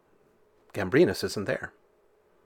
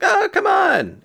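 much higher, slow loud whine; pleading coax; 6 dB louder, slower than usual. Retold or imitated. Gambrinus coaxing the devil, loud high pleading. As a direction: wheedle him, drawn out and high. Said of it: "And Gambrinus like".

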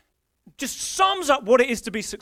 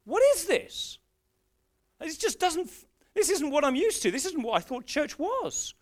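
bewildered; 5 semitones higher than usual. Retold or imitated. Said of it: "I was, like, looking and thinking".

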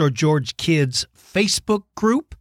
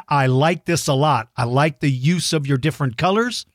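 ordinary talk

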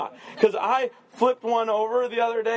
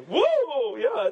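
high, playful whoop; hammed-up gleeful cry; mock thrill; 6 semitones higher than usual. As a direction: pretend to relish a slip, pitched up. Mimes slipping again on purpose.

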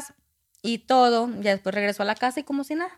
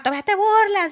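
indignant mother; 11 semitones higher, 5 dB louder than usual. Retold or imitated. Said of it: "Mi mamá".

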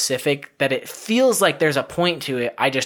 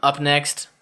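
ordinary talk

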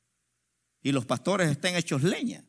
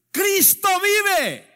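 high, loud, drawn-out victory cry; shout of jubilation; 14 semitones higher, 6 dB louder, much slower than usual. Shown as a demonstration. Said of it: "dar voces de júbilo". (grito de júbilo)

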